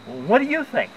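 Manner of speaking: high-pitched incredulous comeback; sarcastic retort. Retold or imitated. Patient answering nurse's obvious question, high sarcastic retort.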